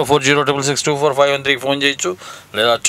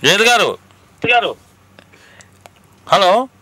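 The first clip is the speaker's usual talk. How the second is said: calling out; 7 semitones higher, slower than usual. Real, not acted. Relaying words over bad line.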